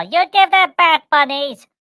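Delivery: high, loud call telling the bunnies to give it back. Real, not acted. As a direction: shout across the room at the pets. Scolding rabbits chewing the cymbal.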